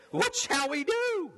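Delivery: high, drawn-out cry; crowd's outcry. Acted. Crowds answering the Baptist's warning, a high outcry.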